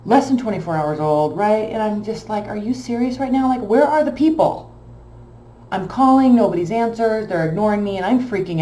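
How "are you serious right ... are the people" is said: higher, louder, fed-up 'are you serious'; exasperated disbelief. Retold or imitated. Retelling her panic over the abandoned duckling, exasperated and raised. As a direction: throw up your hands in disbelief.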